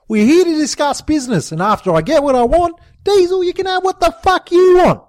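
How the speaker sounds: high, loud bossy tirade; yelled. A narrator's reading. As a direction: roar at them to get control. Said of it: "Jimbo yelled".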